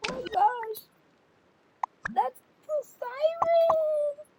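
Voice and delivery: high, loud, drawn-out whine; crybaby wail. Pretend. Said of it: "stop being so much crybaby".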